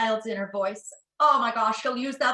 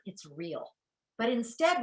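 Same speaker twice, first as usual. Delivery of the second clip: usual speaking voice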